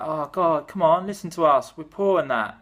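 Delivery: mock-whiny plea for a union; pleading workers. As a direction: beg like a desperate worker. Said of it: "poor and working people were like".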